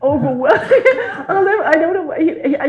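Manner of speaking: can't hold back the laughter while telling it; laughing. Real, not acted. Telling a friend about the policeman, laughing hard.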